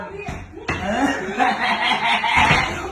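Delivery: long burst of real laughter; long gloating laugh. Real, not acted. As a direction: laugh at the one shut out. Laughing at shut-out partner. (mahabang halakhak)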